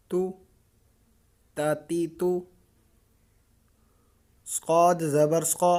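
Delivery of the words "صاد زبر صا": صاد زبر صا with a thick, heavy ص sound; heavy saad. Shown as a demonstration. Teacher drilling a child on the heavy saad sound. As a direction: give صا a full, heavy emphatic ص. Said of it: "صاد کو موٹا کر کے پڑھیں گے".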